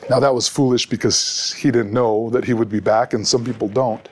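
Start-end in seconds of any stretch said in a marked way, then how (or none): none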